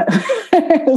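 high short laugh; sheepish giggle; embarrassed laugh. Real, not acted. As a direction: laugh at yourself. Laugh at dream student.

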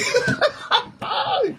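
high-pitched laugh; real high laughter. Real, not acted. Preacher laughs at own point.

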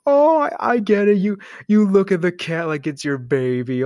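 mock gushing. Imagined listener approves of cat parents, mock gushing. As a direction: gush as an understanding listener.

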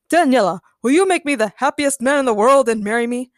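loud, earnest suitor's plea; a hint of a swooning romantic lead; earnest proposal. A narrator's reading. Daniel on one knee proposing to Daniela, earnest.

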